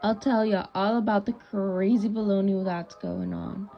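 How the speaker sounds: usual speaking voice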